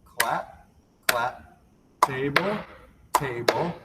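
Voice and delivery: each word spaced out slowly; a slow teaching pace for beginners; slow motion. Shown as a demonstration. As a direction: call the moves slowly, one by one. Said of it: "So slow motion looks like this".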